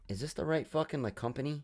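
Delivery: muttered doubt. Retold or imitated. Passenger quietly doubting the helicopter company, softly muttered. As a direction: ask it under your breath. Doubtful question under his breath in story.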